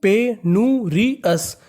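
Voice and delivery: पेनूरियस stretched syllable by syllable, pe-nu-ri-yas; very slow, sounded out. Shown as a demonstration. Teacher answering his own quiz question, very slowly. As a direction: drag out पेनूरियस for the class to copy. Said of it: "क्या बन गई?".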